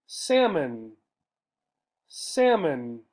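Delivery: "salmon" said SAM-un with no L sound; silent L. Shown as a demonstration. Teacher models "salmon" for learners, no L. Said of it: "we do not say this L".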